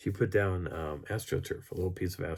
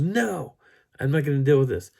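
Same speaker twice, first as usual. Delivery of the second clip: loud, high mock outburst; hammed-up loud protest; acted refusal; 7 semitones higher, 8 dB louder than usual. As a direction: burst out refusing, imitating a panicked friend. Acting her refusal in quarrel.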